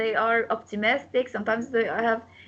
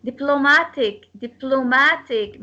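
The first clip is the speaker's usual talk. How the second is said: "Diplomatic" with MA punched; stress on MA. Shown as a demonstration. Teacher demonstrates word stress, MA stressed in "Diplomatic". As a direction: hit the MA in "Diplomatic". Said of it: "will take the stress".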